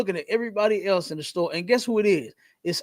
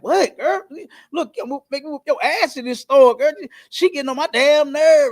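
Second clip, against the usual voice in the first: raised, louder mock snap at a daughter; imitating a mother losing it in a store, voice pitched higher; mama snapping; 6 semitones higher, 4 dB louder than usual. Mother in a store yelling at her daughter, mock angry snap. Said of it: "the mama snapped".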